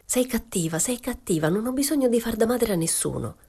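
the nene lashes out at Momina, aggressive and high; aggressive exclamation. A narrator's reading. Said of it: "la voce aggressiva della nene esclamare".

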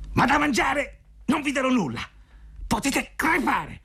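sfogo stridulo, molto acuto; rabbia del vecchio. A narrator's reading. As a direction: nega con rabbia, allungando la frase finale. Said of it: "gridò con ira". (vecchio furioso dalla voce acutissima)

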